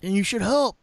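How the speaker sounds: lightly voiced mock Andrew. Host mimicking his co-host urging listeners, Andrew voice. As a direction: put on a mild impression of your co-host. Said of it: "this is my Andrew voice".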